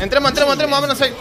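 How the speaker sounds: high, loud call to rush in; cover me shout. Real, not acted. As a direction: yell at the team to push. Calls team to push in.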